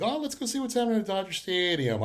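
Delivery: playful self-quote of a spur-of-the-moment plan; excited idea. Retold or imitated. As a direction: pitch it up like a fresh bright idea. Retells own sudden idea.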